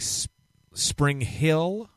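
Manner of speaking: normal talking voice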